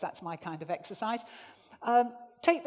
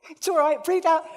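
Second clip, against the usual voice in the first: loud reassurance; 7 semitones higher, 10 dB louder than usual. Real, not acted. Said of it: "reassuring out-of-breath singers".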